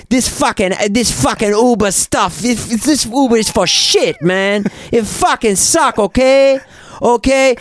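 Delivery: put-on high, irate Korean-accented driver. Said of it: "I can't do the accent".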